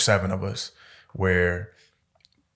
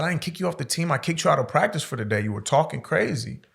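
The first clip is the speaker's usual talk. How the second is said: coach correcting; 7 semitones higher than usual. Retold or imitated.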